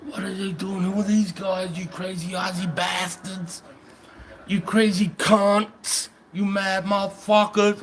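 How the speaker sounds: high, mock-baffled Yank voice; imitating American soldiers. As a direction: squeak out the Yanks' disbelief, slowing on the insults. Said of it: "They're like".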